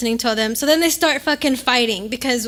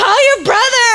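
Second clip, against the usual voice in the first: laughing answer; 10 semitones higher, 8 dB louder than usual. Retold or imitated. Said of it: "And I was like".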